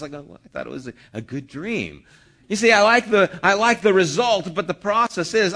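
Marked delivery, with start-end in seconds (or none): 0.2-2.0 softer, lower sheepish answer; scolded student meekly defending the plan; meek reply. Retold or imitated. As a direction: mumble it like a scolded kid.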